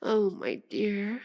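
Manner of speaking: ordinary delivery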